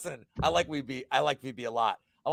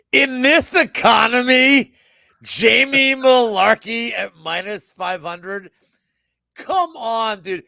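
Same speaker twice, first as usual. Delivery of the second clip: incredulous rant; 8 semitones higher, 9 dB louder, slower than usual. Real, not acted. Ranting at the betting odds.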